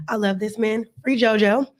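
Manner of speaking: usual speaking voice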